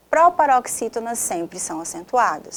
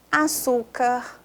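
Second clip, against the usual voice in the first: açúcar slow, raised and held on ÇÚ; stretched stressed syllable; 4 semitones higher, much slower than usual. Shown as a demonstration. Teacher classifying words aloud, açúcar stretched on ÇÚ. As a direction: lift and drag the ÇÚ in açúcar.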